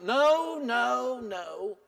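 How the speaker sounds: sharp high no, then dropping away; a quick no no no trailing off; emphatic no fading out. Real, not acted. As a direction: deny it firmly, then let it fade.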